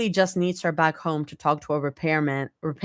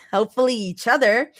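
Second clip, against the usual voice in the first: teasing; 6 semitones higher, 3 dB louder, faster than usual. A narrator's reading. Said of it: "Zoe teases".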